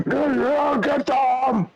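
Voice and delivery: high, gruff goblin shout. Acted. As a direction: bark it like an excited goblin. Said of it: "You guys all hear a gruff voice, uh, in Goblinoid".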